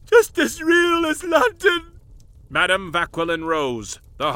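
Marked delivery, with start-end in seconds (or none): 0.1-1.8 much higher and louder near-crying shout on Just; ecstatic cry. Acted. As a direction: cry it out, hitting Just hard. Professor's revelation, crying out.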